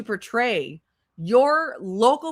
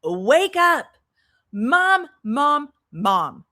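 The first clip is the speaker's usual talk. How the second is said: higher, louder retold child calling Mom; 5 semitones higher, 4 dB louder, much slower than usual. Retelling how the son tried to wake his mother, a raised mock child call. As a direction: pitch up and call out like a kid waking his mother. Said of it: "He said".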